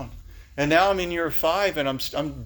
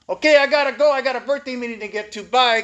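loud, high, abrupt sign-off; mimicked tough guy's loud phone goodbye; Tomas hanging up; 8 semitones higher, 6 dB louder than usual. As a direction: imitate a tough sponsor briskly ending the call. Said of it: "That's how he talks".

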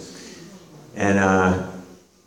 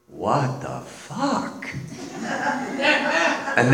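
slow, laughing through the words; 9 semitones higher, 6 dB quieter, much slower than usual. Retold or imitated. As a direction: let the laugh swallow the line. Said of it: "He was laughing and saying".

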